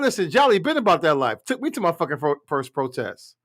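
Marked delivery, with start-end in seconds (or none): none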